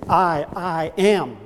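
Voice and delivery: slow, doubled "I, I am", each word weighted; emphatic I AM. Shown as a demonstration. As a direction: set the first "I" apart, then lean on "am". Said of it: "that's what Jesus is doing here".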